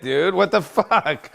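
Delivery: usual speaking voice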